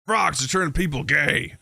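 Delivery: mock conspiracy rant; put-on ranting proclamation; Alex Jones. Podcast host parodying a conspiracy broadcaster, mock rant. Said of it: "an Alex Jones-esque".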